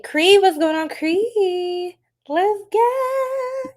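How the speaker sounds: rising excited welcome; excited greeting. Real, not acted. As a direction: hype up the viewer by name. Greeting a live chatter.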